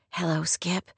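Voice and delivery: softly. A narrator's reading. Rory greeting the teenager who rescued her, softly. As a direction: greet him gently, barely above a murmur. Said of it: "she said softly".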